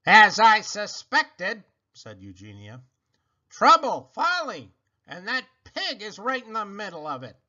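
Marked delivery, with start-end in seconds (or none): 0.0-1.6 put-on slow, loud haughty old woman voice; stern sister. A narrator's reading. Stern Eugenia. 3.5-7.4 stern sister. A narrator's reading. Eugenia disapproves.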